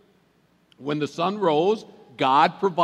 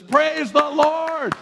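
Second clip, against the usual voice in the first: high, loud, slow "Praise the Lord!"; preacher cueing the church to cheer; joyful exclamation; 12 semitones higher, 6 dB louder, much slower than usual. Shown as a demonstration. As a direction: shout it out to model the response. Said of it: "the appropriate response to this kind of news is".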